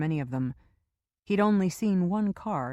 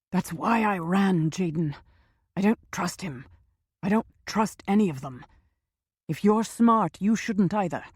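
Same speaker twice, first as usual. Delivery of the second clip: urgent frightened man's voice; nervous scientist; 3 semitones higher, 5 dB louder than usual. Acted. Frightened man pleads.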